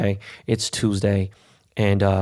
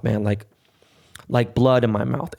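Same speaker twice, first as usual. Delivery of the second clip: usual speaking voice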